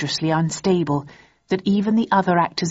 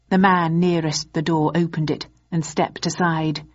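ordinary delivery

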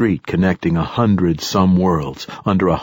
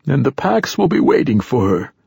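put-on higher-pitched old man's voice; old man character, pitched up; old satyr poet; 7 semitones higher than usual. A narrator's reading. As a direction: voice an ancient poet foretelling events. Old poet continues speaking.

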